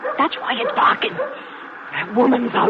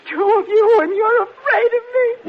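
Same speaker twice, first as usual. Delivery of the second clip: tearful plea; 10 semitones higher than usual. Acted. Old woman pleads, accused.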